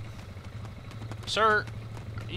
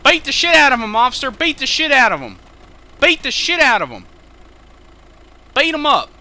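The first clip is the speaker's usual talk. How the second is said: shouting; 4 semitones higher, 6 dB louder than usual. Acted. Urging officer to beat him.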